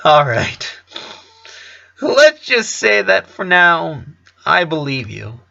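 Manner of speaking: skeptical. A narrator's reading. Son humouring his father's unlikely story, skeptical. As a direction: play along without really buying it. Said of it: "a tone that hinted that he wasn't really buying that story".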